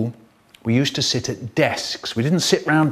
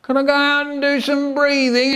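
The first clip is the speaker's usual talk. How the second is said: put-on high whining child; whiny pupil begging the teacher to breathe; whiny kid; 12 semitones higher than usual. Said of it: "a whiny kid going".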